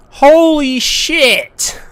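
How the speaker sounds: ordinary talk, nothing marked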